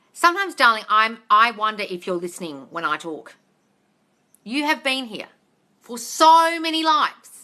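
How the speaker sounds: normal talking voice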